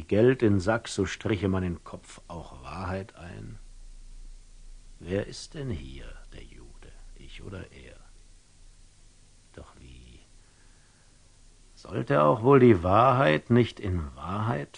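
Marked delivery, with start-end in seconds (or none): 5.0-10.2 lower, softer, unvoiced whisper; a secret whispered suspicion; whispered aside. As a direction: hush it as a dangerous thought.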